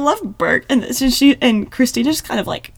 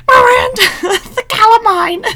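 mock Addison; 12 semitones higher, 7 dB louder than usual. Imitating Addison calling Bailey.

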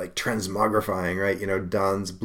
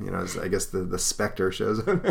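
usual speaking voice

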